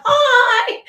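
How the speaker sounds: very high, loud squealed hello; hammed-up shriek of arriving relatives; mock gushing greeting. Acts relatives turning up uninvited.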